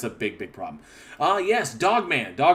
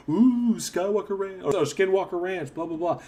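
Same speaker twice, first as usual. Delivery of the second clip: higher, mock-dramatic reality show voice; mocking TV hype; 7 semitones higher than usual. Streamer mocking the Skinwalker show, hammed-up high hype voice. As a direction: parody the cheesy TV narrator. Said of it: "played up for the".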